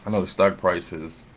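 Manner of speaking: ordinary talk, nothing marked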